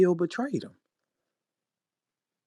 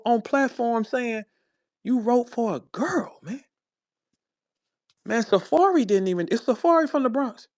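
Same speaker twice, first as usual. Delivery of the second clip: pitched up, riled and incredulous; worked-up rant, higher throughout; heated outrage; 8 semitones higher than usual. Real, not acted. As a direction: call him out, disbelief rising.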